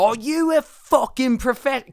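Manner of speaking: high, slow mock rant in a put-on accent. Podcaster replaying the actor's rant to show his accent, hammed-up and high. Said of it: "go in and out of his accent".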